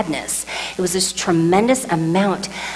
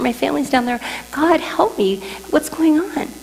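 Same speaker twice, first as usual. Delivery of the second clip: rising higher into panicked pleading; panicking; 7 semitones higher than usual. Retold or imitated. Mother calling to God, higher panicked plea. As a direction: climb into a high panicked cry for help. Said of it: "I'm panicking like crazy".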